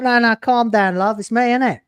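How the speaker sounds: calm her down like a Cockney geezer; Cockney bloke. Pretend. Cockney Doctor calms his panicking assistant. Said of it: "some Cockney bloke".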